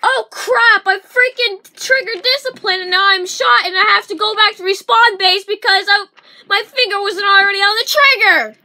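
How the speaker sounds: loud, exasperated retelling; mock panicked player. Vlogger voicing a shot player complaining loudly. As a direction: blurt it out in a loud fluster. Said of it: "And it's like".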